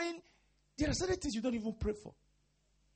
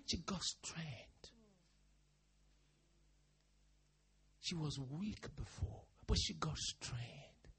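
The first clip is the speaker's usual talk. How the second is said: slow, whispered emphasis; slow reverent hush; 8 dB quieter, much slower than usual. Real, not acted. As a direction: let each word land softly and slowly.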